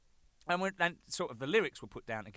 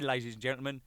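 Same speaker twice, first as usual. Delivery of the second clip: ordinary delivery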